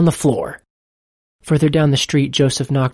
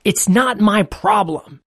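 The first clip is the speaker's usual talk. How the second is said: higher, slower, stubborn refusal; mild anger at the unseen voice, higher and slower; angry; 6 semitones higher, slower than usual. A narrator's reading. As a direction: dig in your heels. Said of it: "Anger flared up in his heart".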